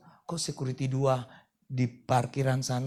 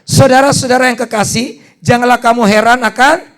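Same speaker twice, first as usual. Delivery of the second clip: full-force preaching; 7 semitones higher, 18 dB louder than usual. Acted. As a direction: proclaim it to the whole church.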